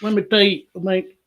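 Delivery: hammed-up Southern good ol' boy; Larry the Cable Guy. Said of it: "do some Cable Guy jokes".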